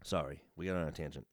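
low, sultry murmured apology; sexy voice. Pretend. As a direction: purr it seductively. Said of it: "in a sexy voice too?".

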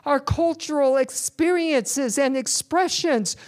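loud, driving sermon delivery, pushing each phrase; full-force preaching. Acted. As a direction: preach it hard to the whole congregation.

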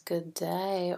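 ordinary delivery, nothing marked

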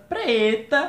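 "preta" imitated high and loud; changed intonation. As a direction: mimic the giveaway lift on "preta". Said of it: "a Clara mudou a entonação quando ela foi falar preto".

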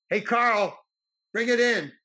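director's loud, high call to crew. Retold or imitated. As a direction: holler across the film set. Director calls out to crew man.

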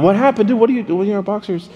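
high-pitched puzzled questioning; baffled roommate. Retold or imitated. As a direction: imitate a startled friend asking what's going on. Roommates waking, baffled, voiced in story.

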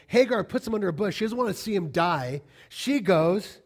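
ordinary talk

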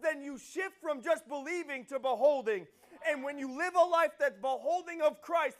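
loud, high, driving sermon delivery; fervent pulpit preaching, building then easing off; full-force preaching. Acted. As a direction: preach it hard to the room.

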